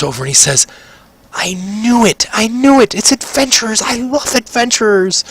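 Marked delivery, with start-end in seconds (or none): 1.3-5.2 put-on high-pitched, thrilled child voice; overjoyed young halfling, voice way up high; excited little boy. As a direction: squeal it like a starstruck kid. Wide-eyed young halfling meets adventurers.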